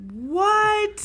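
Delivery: high, sharp disbelieving what; sudden shock. Real, not acted. Shock at death by pitch.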